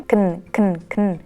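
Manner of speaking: "can" as a short vowelless "kn"; reduced "can". Shown as a demonstration. Teacher drills the weak form, reduced "can". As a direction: repeat "can" as clipped k plus n.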